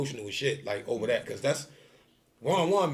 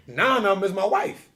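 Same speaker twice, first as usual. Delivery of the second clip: normal talking voice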